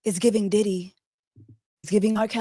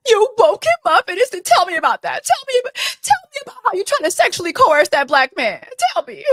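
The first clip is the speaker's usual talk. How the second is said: much higher, fast, gushing mock interviewer; mocking podcaster voice; 13 semitones higher, 6 dB louder, faster than usual. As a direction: ham up a nosy host egging her on. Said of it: "that's the tone of this".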